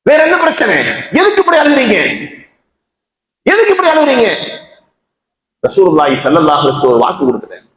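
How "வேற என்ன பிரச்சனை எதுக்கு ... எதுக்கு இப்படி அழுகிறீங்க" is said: high, urgent pleading questions in a preacher's retelling; insistent questioning. Acted. Friend asking dying Salman why he weeps, insistent questioning. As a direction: press the question at a much higher pitch.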